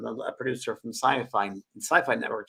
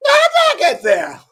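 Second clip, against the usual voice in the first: high, loud, slow hammed-up shock; mock astonishment; 13 dB louder than usual. Imitating Oprah seeing the photo, high mock shock. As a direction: gasp it out in mock amazement. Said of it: "Oprah goes".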